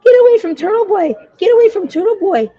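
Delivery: high, loud sneering imitation of the critics; mocking quote. Mocking the doubters who warned her off. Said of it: "Everybody said".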